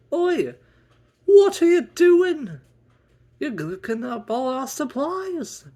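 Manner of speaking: high, loud scolding outburst; shrill angry Kendall. Acted. Kendall scolding him over the breakfast supplies, shrill and angry. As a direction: yell at him for using up supplies.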